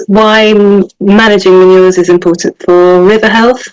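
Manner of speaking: normal talking voice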